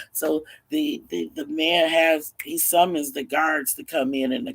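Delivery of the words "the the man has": usual speaking voice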